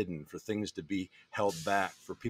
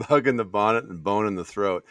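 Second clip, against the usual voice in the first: laughing; 8 dB louder than usual. Real, not acted. Repeating the mixed idiom laughing.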